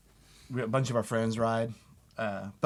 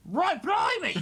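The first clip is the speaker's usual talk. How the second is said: mock British; 3 dB louder, slower than usual. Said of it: "He's British".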